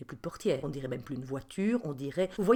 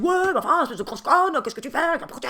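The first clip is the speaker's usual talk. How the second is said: much louder, higher furious insult; a driver yelling at the woman who opened the door; angry van driver shouting; 11 semitones higher, 10 dB louder than usual. Retold or imitated. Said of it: "il se met à me gueuler".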